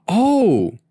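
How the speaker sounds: high, loud surprised gasp; sudden loud gasp of surprise. A narrator's reading. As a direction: catch on the sudden realisation. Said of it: "James gasped".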